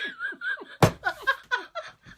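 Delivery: rising helpless giggle; a real high-pitched laugh. Real, not acted. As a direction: crack up at your own joke. Laughing at her own joke.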